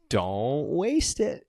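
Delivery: drawn out, slow and ominous; slow creepy warning; slow menacing quote. Retold or imitated. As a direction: stretch it out like a threat. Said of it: "And he goes".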